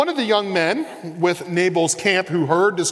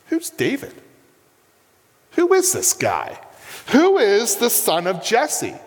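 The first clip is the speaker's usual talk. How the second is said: scornful Nabal; 4 semitones higher than usual. Acted. Nabal's scornful retort voiced.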